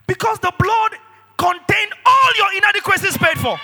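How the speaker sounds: high, loud preacher's cry; full-force preaching. Real, not acted. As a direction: preach it out with fire.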